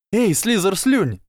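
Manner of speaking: high, drawn-out taunting call; jeering shout. A narrator's reading. Ernie taunting a Slytherin, shouted. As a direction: holler the insult up high. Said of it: "закричал Эрни".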